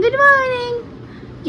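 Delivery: Good morning sung out very high and very slow; long high sing-song good morning; sing-song stretch. Real, not acted. Greeting her baby.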